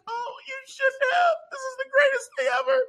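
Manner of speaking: very high, fast, tearful falsetto; mock crying. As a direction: squeal it like gushing happy tears. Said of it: "she's crying. She's like".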